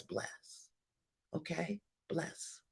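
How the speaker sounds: breathy whisper, almost silent; whispered bless. Real, not acted. Preacher closing the blessing in a hushed whisper. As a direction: let it fall to a hush. Quiet close after fervent blessing.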